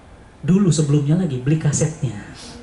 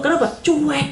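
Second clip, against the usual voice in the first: normal talking voice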